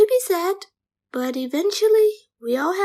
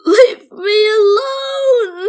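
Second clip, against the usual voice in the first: crying shout; 2 semitones higher, 10 dB louder, much slower than usual. Acted. Child storms off crying.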